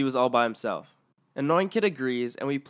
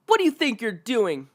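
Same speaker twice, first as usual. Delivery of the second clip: high, shrill-voiced demand; angry entitled mom; 9 semitones higher, 3 dB louder than usual. Entitled mother confronting narrator, high and loud. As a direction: confront the kid like an outraged mother.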